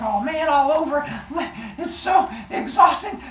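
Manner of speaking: high, laughing whine; mock exasperation. A fighter tired of regrowing limbs, mock whine.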